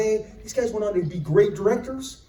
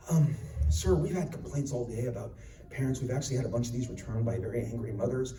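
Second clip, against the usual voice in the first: much lower, softer, hesitant warning; hushed clerk; 7 semitones lower, 9 dB quieter than usual. Retold or imitated. Store clerk cautiously warning about the graphic game, low and soft. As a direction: tiptoe around the customer. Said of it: "clerk again".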